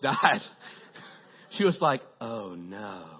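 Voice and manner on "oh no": low, soft, soothing "librarian". Retold or imitated. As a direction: imitate her calm, hushed way of talking. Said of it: "It's like a librarian".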